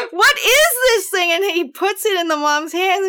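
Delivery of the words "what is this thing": high, mock-disgusted put-on voice; disgusted stepfather. Stepfather handing the thing to the mother, mock disgust. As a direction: ham up his revulsion in a high voice. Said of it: "And he's like".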